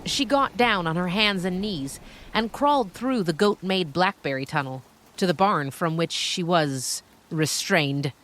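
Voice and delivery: plain reading voice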